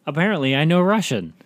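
thrilled to discover a new skill; eager, gushing pretend excitement; excited. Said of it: "I'd be like".

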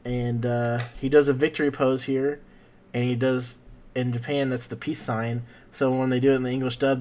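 ordinary talk

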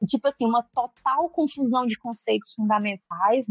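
ordinary delivery

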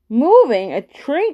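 mildly startled questions, pitch jumping; surprised cry. A narrator's reading. Man doubts the boy's sighting, crying out surprised. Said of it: "cried Joe".

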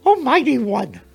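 very high, squeaky little mouse voice; squeaking mouse. Acted. Captured mouse addressing the bear, high squeaky voice. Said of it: "squeaked the mouse".

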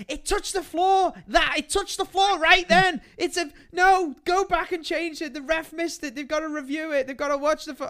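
high, urgent pleading at the screen; breathless high outrage at a replay; frantic kid protest. Retold or imitated. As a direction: beg the ref like a desperate young fan. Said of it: "and we're like".

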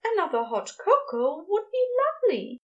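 high, delighted gush; excited gushing. A narrator's reading. Ezra accepts Crowley's offer of a drink, gushing excitedly. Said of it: "Ezra gushed excitedly".

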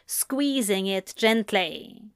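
plain reading voice

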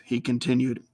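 plain reading voice

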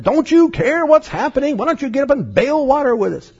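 high, frantic plea over the storm; panicked apostles. Retold or imitated. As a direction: cry out like scared men in a sinking boat.

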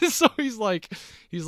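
high, laughing through the words. Real, not acted. Joking that Jake Paul is unbeatable, laughing. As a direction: break up laughing as you start talking.

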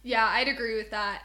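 normal talking voice

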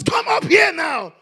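high, loud, slow shouted promise; booming scripture quote. Acted. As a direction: proclaim it like God speaking from heaven. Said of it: "So the Bible says".

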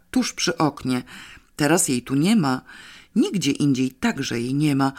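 ordinary delivery, nothing marked

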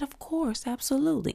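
John B. Retold or imitated. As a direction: answer in a hushed, lifted singer's voice. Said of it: "And that's how he talk".

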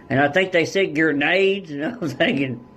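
ordinary delivery

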